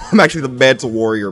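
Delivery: quick, emphatic boast; proud realization. Real, not acted. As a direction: declare it with growing pride. Repeats the boast louder.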